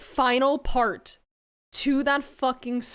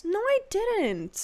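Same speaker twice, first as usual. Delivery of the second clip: slow, high-pitched protest; drawn-out denial; 7 semitones higher, much slower than usual. Real, not acted. Streamer denying she hit her friend, drawn-out protest. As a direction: stretch out the denial, higher in pitch.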